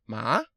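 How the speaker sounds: ma rising like a question; rising second tone. Shown as a demonstration. Teaching the second tone, ma rising like a question. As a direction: lift ma upward as if asking. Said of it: "second tone is rising tone".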